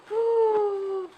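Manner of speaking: sharp high gasp of delight; real gasp of joy. Real, not acted. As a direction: gasp at something adorable. Unboxing tiny books, overjoyed.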